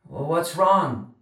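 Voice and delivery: slow, high voice from far off; distant boy. A narrator's reading. Charlie under the house to Lonnie, distant and slow.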